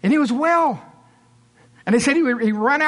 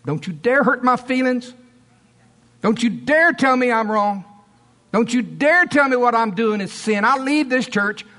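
mock outrage of an offended churchgoer; indignant; 3 semitones higher than usual. Offended member to preacher over sin, indignant. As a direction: hit each "dare" hard, threatening to walk out.

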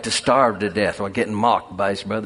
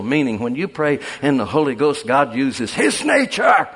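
building preaching; 6 semitones higher, 6 dB louder than usual. Real, not acted. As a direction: drive it home, climbing to "nature".

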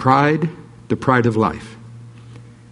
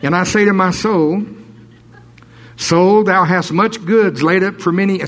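higher and louder, preaching it out; rising into full preaching voice; preacher breaking into a louder, higher read; 7 semitones higher, 4 dB louder than usual. Shown as a demonstration. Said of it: "I'm not supposed to preach".

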